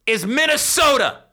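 high, booming booth introduction; grand announcement. Real, not acted. Host unveiling the producer's new nickname, grand announcement. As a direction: announce it high and proud, drawn out.

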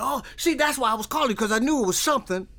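loud, high, rushing excitement; excited realization, imitated. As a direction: act out someone suddenly vindicated, voice leaping up. Brother's delighted realization, acted out.

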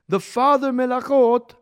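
slow, loud emphasis on "father"; stress on father. Shown as a demonstration. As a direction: hit "father" hard and stretch it. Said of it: "I'm stressing the word Av".

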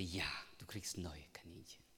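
put-on soft whispered promise to a child; whispered reassurance. Father whispering a promise to his daughter. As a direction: whisper the comfort to her.

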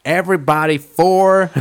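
high, loud call to the room; dad calling vote. Retold or imitated. Dad putting the wedding to a vote, calling out. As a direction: announce it as a father taking charge. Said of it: "the dad is like".

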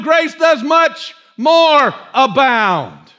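slow, drawn-out declarations; emphatic preaching. Real, not acted. Preacher proclaiming infinite grace to the church, slow and emphatic. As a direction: stretch each key word out with force.

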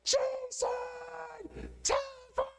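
high yell across the house calling a name; shrill-pitched call up the stairs, held out long; kids yelling. Retold or imitated. Kid yelling to a sibling that the phone is for them, high call. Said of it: "the kids would yell".

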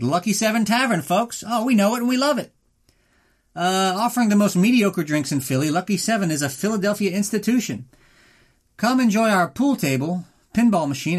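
cheery mock ad read, selling a dive bar to listeners. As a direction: sell the tavern like a cheesy radio spot. Reads a sponsor ad.

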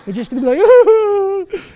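loud, high laughing tease; teasing the crowd, laughing loudly. Real, not acted. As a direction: laugh through the joke at the men.

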